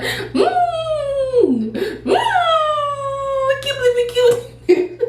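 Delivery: high, laughing-crying wail; half laughing, half crying in a high voice; niece crying. Retold or imitated. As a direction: imitate a sobbing little niece. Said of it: "My niece Kennedy was so sad".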